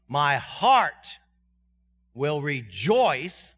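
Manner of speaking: will rejoice drawn out slowly; slow emphatic. Shown as a demonstration. Preacher stresses David's choice, slow emphatic. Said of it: "I'm making a choice there".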